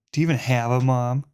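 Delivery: fast snappy taunt; quick taunt. Shown as a demonstration. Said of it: "that's good".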